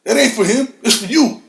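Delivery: usual speaking voice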